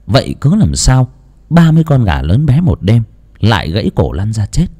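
ordinary delivery

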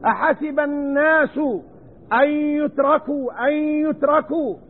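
أن يتركوا with the nun merged into the ya, slowly; slow recitation with idgham. Shown as a demonstration. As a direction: slide أن into يتركوا as انيتركوا. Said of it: "فهذا موضع إدغام".

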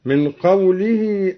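ordinary talk

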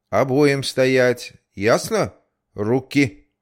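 loud, slow commanding order; stern policeman. Acted. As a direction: bark it like a strict guard. Policeman barks orders at kids. (строгий милиционер)

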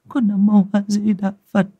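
high, trembling Buddhist chant; frightened prayer. Acted. Wife praying to the heavens after seeing the eye, frightened. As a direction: pray it shakily under her breath. Terrified woman praying, bowing.

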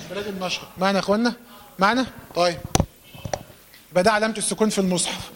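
normal talking voice

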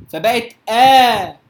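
the آ stretched long and loud; long madd. Shown as a demonstration. Quran teacher showing students the lengthened madd. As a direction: hold the آ out for six beats. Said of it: "فعايز أبدل الهمزة الثانية حرف مد".

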